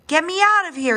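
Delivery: high, urgent storybook yell. A narrator's reading. Father dinosaur stuck in river mud, yelling. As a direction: call out high to be pulled free. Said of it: "he yelled".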